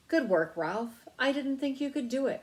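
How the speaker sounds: a hint of a hearty uncle. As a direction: clap him on the back with your voice. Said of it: "said Uncle Lester heartily".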